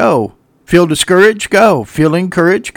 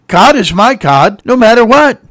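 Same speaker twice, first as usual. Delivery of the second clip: raised, higher proclaiming voice; bold declaration; 6 semitones higher, 6 dB louder than usual. Acted. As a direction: stand up and proclaim it. Voicing God's people standing up.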